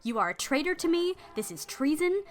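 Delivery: loud, high furious tirade; explosive rage. Acted. Betrayed lady explodes at her maid, loud furious tirade. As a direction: explode at the traitor. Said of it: "She explodes".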